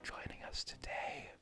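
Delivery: soft ASMR whisper; breathy intimate stream whisper. Pretend. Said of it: "A S M R stream".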